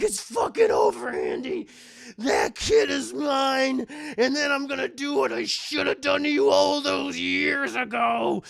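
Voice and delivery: loud villain snarl, slow in places; menacing Chucky voice. Retold or imitated. Chucky on Andy's back threatening him, loud menacing snarl. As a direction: threaten him like a killer doll.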